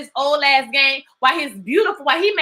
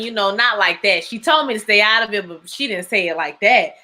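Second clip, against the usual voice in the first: ordinary talk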